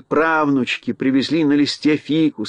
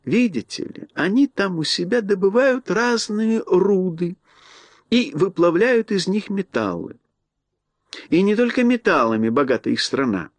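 mouse queen voice; 5 semitones higher than usual. Acted. Queen explains miners.

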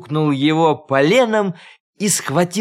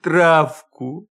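drawn-out beastly plea; wolf-voiced donkey; 2 semitones higher, 3 dB louder, much slower than usual. A narrator's reading. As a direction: insist slowly, stretching each word.

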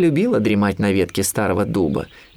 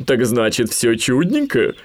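probing owl; 2 semitones higher, slower than usual. A narrator's reading. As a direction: probe slowly as the owl. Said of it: "попыталась докопаться до истины сова". (сова допытывается)

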